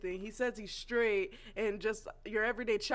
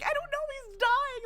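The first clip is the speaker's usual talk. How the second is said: much higher, louder panicky squeak; mock panicked reply; 4 dB louder than usual. Answering Mike while Rick chokes, high panicky squeak. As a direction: squeal it like you're caught off guard. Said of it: "I'm like".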